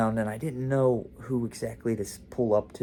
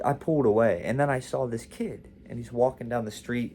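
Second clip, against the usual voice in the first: ordinary delivery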